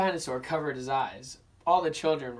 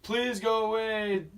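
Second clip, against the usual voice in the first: zoo man voice; 7 semitones higher, 6 dB louder than usual. Acted. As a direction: shoo people off as the zoo man. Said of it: "said the zoo man".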